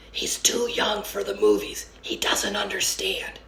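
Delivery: hushed whisper to dad; boy whispering to his father in the cinema. A narrator's reading. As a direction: lean in and whisper it.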